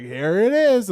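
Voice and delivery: hammed-up unveiling; mock big reveal. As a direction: present it like a stage host. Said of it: "and they're like".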